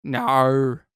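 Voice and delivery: Australian "Nar" with a long open vowel. Pretend. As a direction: copy the Aussie "Nar" for no. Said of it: "That's how they say no".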